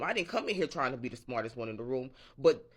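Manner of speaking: ordinary talk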